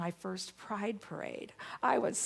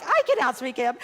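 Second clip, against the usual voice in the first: loud, raised, defiant boast; retold power voice; 5 semitones higher, 10 dB louder than usual. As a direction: re-enact rising to a shouting contest. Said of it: "I have a very good power voice".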